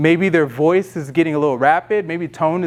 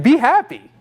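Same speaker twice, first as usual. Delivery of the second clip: mock stern father, higher and louder; father ordering; 7 semitones higher, 4 dB louder than usual. Father telling his upset kid to cheer up, mock stern. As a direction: bark it like a dad demanding a smile. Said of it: "where the father's looking at the kid".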